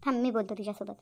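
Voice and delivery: a hint of a calm father taking over; lightly voiced father. Father steps in to talk. (वडील हळू, खालच्या आवाजात बोलतात)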